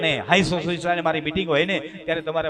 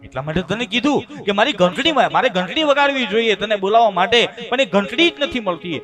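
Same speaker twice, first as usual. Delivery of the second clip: higher, louder explaining; flustered old father; 7 semitones higher, 8 dB louder than usual. Retold or imitated. Old father explaining he can't find the bell.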